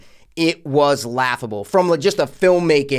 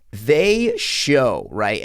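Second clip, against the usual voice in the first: normal talking voice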